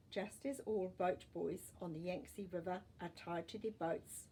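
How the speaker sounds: ordinary delivery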